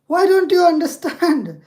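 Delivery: high, loud exasperated outburst; frustrated shout. Real, not acted. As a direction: yell at a machine that won't listen. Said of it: "It's so frustrating".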